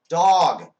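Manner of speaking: very loud corrected word; frustrated texter's loud outburst; exasperated shout. Acted. Acted final correction after repeated typos.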